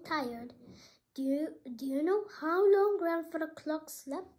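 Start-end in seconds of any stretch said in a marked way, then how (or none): none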